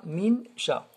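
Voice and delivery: "min" short, its n fully pronounced before "sha"; clear-n mistake. Shown as a demonstration. Teacher repeats the wrong way, crisp n in "min". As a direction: separate "min" from "sha" without nasal hold. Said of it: "on dit pas min sha".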